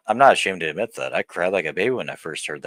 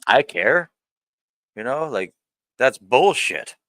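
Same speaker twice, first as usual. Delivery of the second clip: raised, heated voice, higher and louder; angry outburst; 6 semitones higher, 5 dB louder, slower than usual. Real, not acted. Ranting to the camera about his ex, angry and raised. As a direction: snap back hard, offended.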